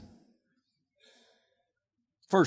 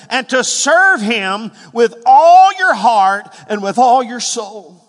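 slow and louder, weighing each word; slow emphatic reading; 4 semitones higher, 7 dB louder, slower than usual. Real, not acted. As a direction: stretch it out with force. Emphatic slow scripture climax.

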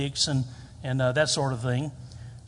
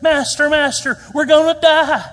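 high, very loud panicked yell; hammed-up terror, pitched way up; under stress; 10 semitones higher, 10 dB louder than usual. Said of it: "they're under, they're under stress".

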